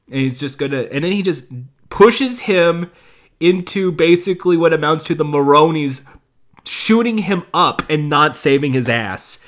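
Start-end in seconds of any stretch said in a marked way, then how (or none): none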